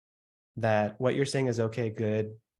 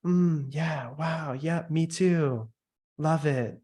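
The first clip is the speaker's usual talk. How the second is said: higher, gushing agreement noises; hammed-up approving interjections; mock eager listener; 4 semitones higher, slower than usual. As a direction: overkeen salesperson. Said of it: "manipulating based on what your body".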